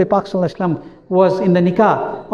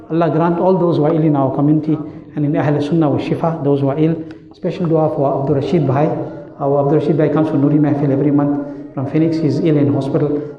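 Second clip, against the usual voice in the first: prayer; 5 semitones lower than usual. Acted. Closing prayer, soft and low.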